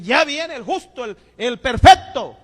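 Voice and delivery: mock him as self-righteous; sarcastic mock. Angry young man sneers at the churchgoers, mocking and loud.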